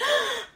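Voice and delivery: high frightened intake of breath; sharp scared gasp. Retold or imitated. A child gasping at an oncoming car, frightened. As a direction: gasp as a frightened little girl. Daughter sees car, freezes.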